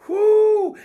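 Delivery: high, loud rising whoo of joy; sudden high shout of praise; ecstatic whoop. Real, not acted. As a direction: let out a joyful preacher's whoop. Preacher's ecstatic outburst.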